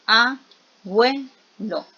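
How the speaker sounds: "abuelo" drawn out slowly as a, bue, lo; syllable split. Shown as a demonstration. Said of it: "donde yo digo". ("abuelo" lento, separado en a, bue, lo)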